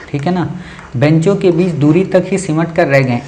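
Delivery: ordinary talk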